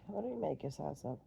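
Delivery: plain reading voice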